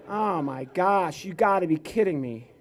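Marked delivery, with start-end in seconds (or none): none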